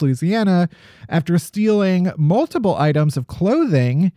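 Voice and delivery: ordinary talk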